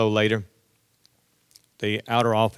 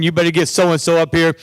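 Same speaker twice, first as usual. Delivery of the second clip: loud, higher demand; demanding students; 6 semitones higher, 6 dB louder than usual. Retold or imitated. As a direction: kids insisting loudly.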